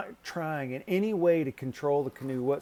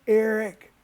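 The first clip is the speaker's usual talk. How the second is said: shouting his name across the river; calling out; 8 semitones higher, 8 dB louder than usual. Retold or imitated. Calling to Eric stuck mid-river, a loud shout. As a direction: yell Eric over the water, high and loud. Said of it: "I'm like".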